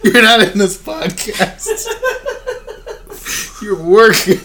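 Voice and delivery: high, loud, drawn-out mock scolding; laughing shout. Real, not acted. Host scolding working colleague off the podcast, laughing shout. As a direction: holler it through laughter across the room. Playfully scolding off-mic coworker.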